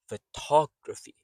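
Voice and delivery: "Photography" with a loud, high "tog"; stress on second syllable. Shown as a demonstration. Teacher sums up the rhythm, "Photography" stressed on second syllable. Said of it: "Tor is strong".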